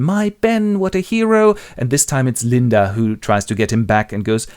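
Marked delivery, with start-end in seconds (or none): 0.0-1.5 high-pitched adoring swoon; acted hero worship. Willie drowns in memory of Ben, adoring. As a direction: gush over your brother, voice lifted. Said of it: "drowns in his memory once again".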